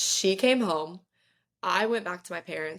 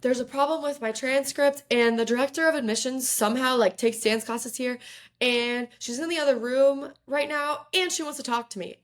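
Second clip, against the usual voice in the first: rambling nervously; 6 semitones higher than usual. Retold or imitated. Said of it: "I'm, like, rambling".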